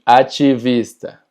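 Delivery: activista slowly, ti as "chi"; TI pronunciado chi. Shown as a demonstration. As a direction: say activista as "achivista". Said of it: "siempre se va a pronunciar chi". (activista lento, dicho «achivista»)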